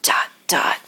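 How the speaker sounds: whispered teasing dot dot; secretive whisper. Acted. Hinting at a secret announcement in a playful whisper. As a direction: hush it like a secret.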